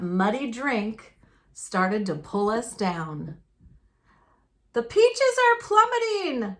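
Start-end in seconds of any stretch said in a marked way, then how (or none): 4.7-6.6 shouted. A narrator's reading. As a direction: cry out in panic as the peaches sink. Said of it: "my sisters shouted".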